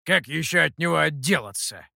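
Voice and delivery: lightly hissed retort; a touch of monkey-demon hiss. A narrator's reading. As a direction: hiss it back under your voice. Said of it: "прошипел обезьяна в ответ".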